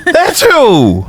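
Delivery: very high falsetto, drawing the point home; mock-indignant squeal; mock outrage. Answering his own "like who", mock outraged squeal.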